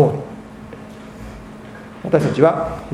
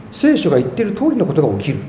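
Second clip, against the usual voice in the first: fast, matter-of-fact 聖書が言っている通り; brisk recap, 聖書 unstressed; quick restatement; faster than usual. Acted. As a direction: rattle it off as a summary.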